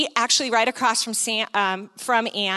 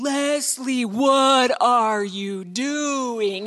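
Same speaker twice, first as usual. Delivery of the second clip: drawn-out slow-motion inner voice; much slower than usual. Retold or imitated. Her mind questions her as she walks over, slow motion. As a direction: stretch every word as if in slow motion. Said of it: "It's like slow motion".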